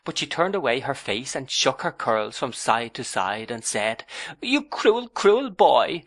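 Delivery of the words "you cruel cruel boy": high, louder wounded cry; hurt reproach. A narrator's reading. Young wife repeats her reproach, high and wounded. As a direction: repeat it like a sulking child. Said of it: "said so many times".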